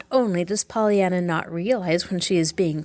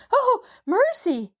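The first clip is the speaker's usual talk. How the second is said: much higher, slow horrified gasp; slower than usual. Acted. Maid fearing the aunt will hear, gasping. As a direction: cry out in dismay. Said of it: "gasped Nancy".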